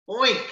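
high squealed oink; a sharp little pig squeal; cried out oink. Acted. Bird cries out a pig's oink by mistake. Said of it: "the little bird cried out".

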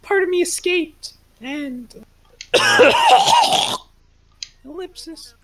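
high, dramatic storytelling voice; high character voice. Acted. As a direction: tell it high like a lost hero. Continues Celeste character backstory.

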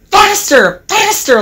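high-pitched teasing goad; gleeful urging. A narrator's reading. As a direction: urge it on like naughty trucks. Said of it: "laughed the trucks".

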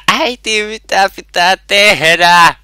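put-on a kid calling out to mother, slow and pleading; teasing a helpless gamer calling for mom; high "Äiti" then a drawn-out question; mock child calling mom. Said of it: "niin huudat sieltä".

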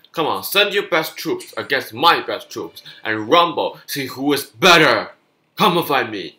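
loud, high challenge to the enemy; loud taunt. Real, not acted. Gamer challenging the enemy army, loud taunt. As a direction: dare the opponent to fight.

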